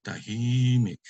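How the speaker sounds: TAHIMIK, long stressed HI; penultimate stress. Shown as a demonstration. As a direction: lean on HI in TAHIMIK. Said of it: "accent is on the second to the last".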